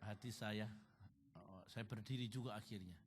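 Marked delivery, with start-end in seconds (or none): none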